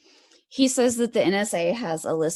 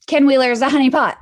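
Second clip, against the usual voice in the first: loud, fast, high emphatic shout; 6 semitones higher, 10 dB louder, faster than usual. Real, not acted. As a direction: belt it out, rushing the words. Repeating the claim, building up.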